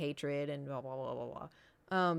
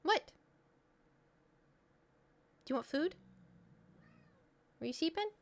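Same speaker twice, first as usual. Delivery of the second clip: pet talk; 10 semitones higher than usual. Real, not acted. Owner asking her pet about food in mild baby talk. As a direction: coo a question at the cat.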